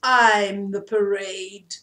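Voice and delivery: slow, loud and proud announcement. A narrator's reading. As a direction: puff up like a vain peacock. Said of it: "said the peacock proudly".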